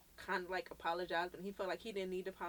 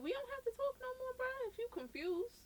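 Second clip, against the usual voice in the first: much higher, brushing him off casually; mock high dismissive. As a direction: toss it off in a high, breezy voice.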